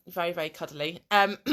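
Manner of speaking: ordinary talk, nothing marked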